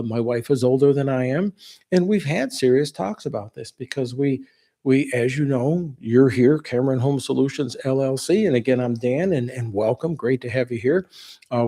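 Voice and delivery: ordinary talk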